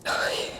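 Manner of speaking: faint voiceless sigh of exhaustion; weak sigh. Acted. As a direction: let out a tired, weak sigh. Poisoned sister, weak, bedridden.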